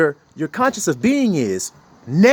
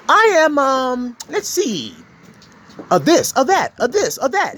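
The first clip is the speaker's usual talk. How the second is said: lightly voiced, higher, preening braggart; a hint of a cocky show-off; mocking boastful ego; 8 semitones higher than usual. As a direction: puff up and list titles, half laughing.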